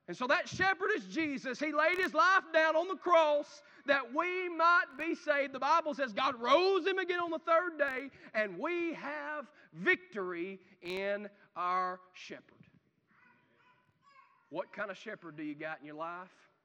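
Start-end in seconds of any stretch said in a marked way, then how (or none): 1.6-12.6 louder, higher proclaiming; preaching shout. Acted. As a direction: declare it in triumph from the pulpit. Preacher shouts gospel climax.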